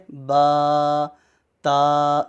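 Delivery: "با، تا" held on a level note; sustained "با، تا"; long drawn-out vowels. Shown as a demonstration. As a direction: stretch each aa evenly. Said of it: "اس کو کیا پڑھیں گے".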